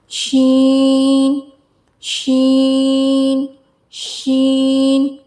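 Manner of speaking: शीन slow with a spread sh; spreading sheen. Shown as a demonstration. Teacher modelling a spreading शीन slowly. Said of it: "इसमें आवाज़ फैलनी चाहिए".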